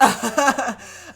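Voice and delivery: high, loud burst of laughter. Real, not acted. Reader amused by Angela's comeback, laughing out loud. Laughs at her own recap.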